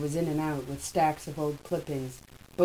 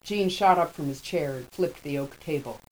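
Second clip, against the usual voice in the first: usual speaking voice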